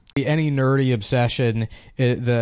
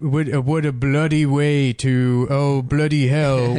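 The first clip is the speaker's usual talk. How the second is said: American nerd putting on British slang; slow, mocking fake-British drawl; slower than usual. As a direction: drag out the British slang in a bad accent. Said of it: "guy from America that".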